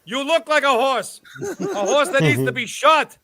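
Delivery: normal talking voice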